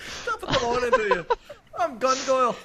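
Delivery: high, loud hammed-up Irish voice; Irish accent. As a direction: ham up a cartoon Irish accent. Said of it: "He leans into it".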